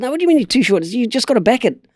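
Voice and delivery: high-pitched retold objection; mock disbelief, pitched well up; incredulous protest. Acted protest to horse's owner.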